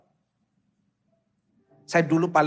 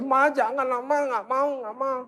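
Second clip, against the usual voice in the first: put-on higher-pitched reluctant kid; whining child; 6 semitones higher, slower than usual. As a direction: plead like a child refusing school. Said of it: "Meskipun dia nangis lebih keras".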